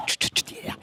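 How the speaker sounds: hushed whisper, hiding from him; whispering while hiding. Real, not acted. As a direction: whisper so he cannot hear. Guest arrives, hushing the others.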